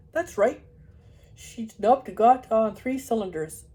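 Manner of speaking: loud, slow Yorkshire farmer. Acted. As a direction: explain it broadly like a dalesman. Farmer explains cow.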